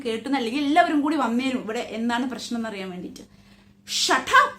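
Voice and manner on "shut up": Ajit's roar, higher and loud. A narrator's reading. Ajit roaring back at his wife, high and loud. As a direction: bellow it back at her like Ajit. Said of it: "Ajit roared out".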